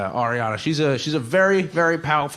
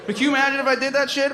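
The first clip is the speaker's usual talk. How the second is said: usual speaking voice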